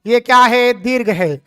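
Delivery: ordinary talk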